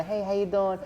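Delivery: high-pitched comedian imitation; Adam Sandler. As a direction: badly imitate a goofy star saying hello. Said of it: "my terrible Adam Sandler impression".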